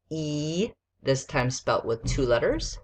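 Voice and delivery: ordinary talk, nothing marked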